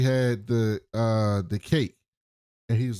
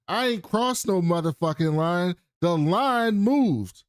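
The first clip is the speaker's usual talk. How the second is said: higher-pitched, indignant denial; defiant retort; 6 semitones higher, 3 dB louder than usual. Retold or imitated. Billings denies crossing the line, defiant. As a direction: push back hard, drawing out the last words.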